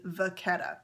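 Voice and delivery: "vachetta" with a hard k, va-KET-ta; Italian-style "vachetta", ch sounded like k; Italian pronunciation. Shown as a demonstration. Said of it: "from an Italian point of view".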